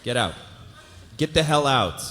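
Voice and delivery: angry shout. Acted. Mike throwing Trevor out of his apartment, angry shout. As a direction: shout him out the door.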